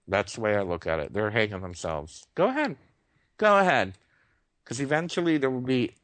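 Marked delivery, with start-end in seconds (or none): 2.3-2.8 airy taunting "Go ahead" pitched way up; high mocking "Go ahead". Real, not acted. Taunting the government. 3.4-3.9 "Go ahead" pushed out loud and high, a defiant dare; louder taunt. Real, not acted. Taunting the government.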